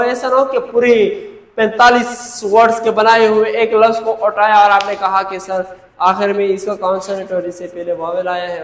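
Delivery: ordinary talk, nothing marked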